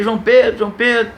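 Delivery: anxious call across a distance. Retold or imitated. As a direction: call out to your son walking away. Calling after son in dream.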